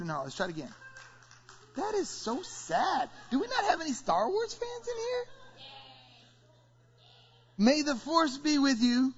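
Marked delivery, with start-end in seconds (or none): 1.8-5.2 laughing mock dismay. Real, not acted. Teasing congregation for no cheers. 7.6-9.1 loud, slow and high, calling to the room; call-out line. Acted. Pastor calls out to the congregation for a response, loud and slow.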